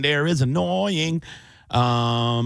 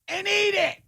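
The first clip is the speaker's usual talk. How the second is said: mock horror; 12 semitones higher than usual. Host telling co-host what the dog ate, high mock horror. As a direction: squeal the punchline in appalled disbelief. Grossed-out retelling of dog habit.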